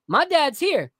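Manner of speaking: put-on high, fast, cheeky child voice; kid voice. Retelling the child comedian calling out his dad, high cheeky voice. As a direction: point out dad like a mischievous kid. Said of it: "And he said".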